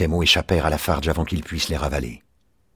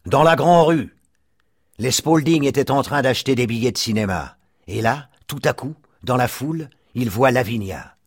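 excited neighbour; 8 semitones higher than usual. A narrator's reading. Mike describing the sighting in the street, excited. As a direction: tell it like big news.

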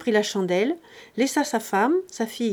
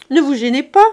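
loud, higher mock rebuke; loud teasing; 5 semitones higher, 12 dB louder than usual. Grandet teases the president kissing his daughter, loud mock rebuke. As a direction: tease him for the kisses.

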